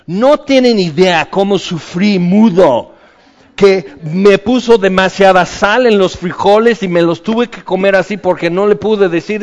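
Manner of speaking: loud, high hammed-up grumble; mock complaining. Zacarías as he did not speak, loud comic complaint. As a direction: whine about the salty beans for laughs. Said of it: "no diciendo".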